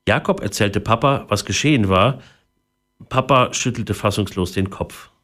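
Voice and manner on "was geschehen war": usual speaking voice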